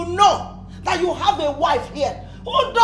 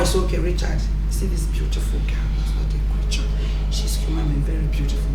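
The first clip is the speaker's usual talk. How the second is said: lower, hushed whisper; 11 semitones lower, 5 dB quieter, faster than usual. Acted. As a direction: hush it lower, admiring. Mother whispering praise.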